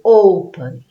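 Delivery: "Open" with a very loud first syllable; stress on first syllable. Shown as a demonstration. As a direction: hit the "O" of "Open" hard. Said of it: "É a sílaba mais forte". ("Open" com o "O" inicial bem forte)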